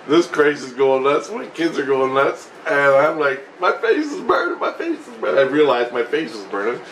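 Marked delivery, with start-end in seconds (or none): 3.6-5.4 very high, hammed-up alarm; imitated high-pitched distress; mock panic. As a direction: yelp it twice in a high voice. Retold panic at burning face.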